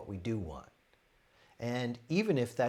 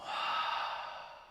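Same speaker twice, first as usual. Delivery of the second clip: audible, airy letting-go sigh; voiceless sigh modelling release; demonstrated exhale. Shown as a demonstration. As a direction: breathe it all out in one sigh. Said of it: "And let it all go".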